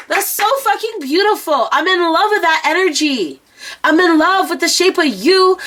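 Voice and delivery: high, excited adoration; gushing praise. Real, not acted. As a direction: rave over them, swept away. Gushing praise over a viewer.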